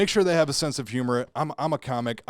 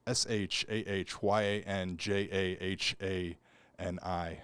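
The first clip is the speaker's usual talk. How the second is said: spelling out slowly; 5 semitones lower, 8 dB quieter, slower than usual. Shown as a demonstration. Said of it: "All the letters are silent".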